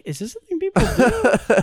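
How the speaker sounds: high, far, disbelieving voice; incredulous quote. Retold or imitated. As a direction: ask it in mock disbelief. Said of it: "And I was like".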